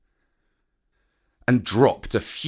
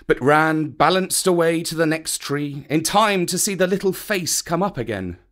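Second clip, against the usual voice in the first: usual speaking voice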